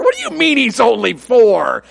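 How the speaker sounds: hammed-up disbelief at the double standard; mock indignation. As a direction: play up the outrage for laughs. Mocking his father's old strictness.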